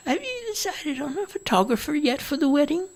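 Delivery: put-on high, whiny old woman; a fussy mother grumbles about the noise, high; complaining old mother. Mother asks about photographer.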